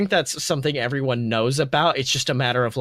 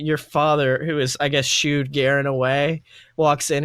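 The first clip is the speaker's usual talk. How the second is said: normal talking voice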